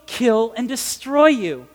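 usual speaking voice

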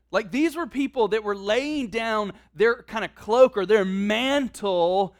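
ordinary talk, nothing marked

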